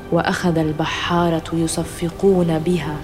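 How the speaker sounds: usual speaking voice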